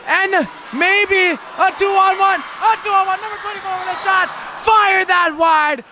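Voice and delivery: rising excited shot call; excited shout. Acted. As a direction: yell out the scoring chance. Scoring chance called out.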